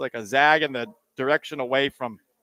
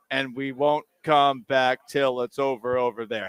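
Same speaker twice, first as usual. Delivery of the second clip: louder, rousing recital of the song; dramatic lyric reading; 5 dB louder than usual. Acted. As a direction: declaim it like a rally cry. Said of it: "I'm gonna read the lyrics".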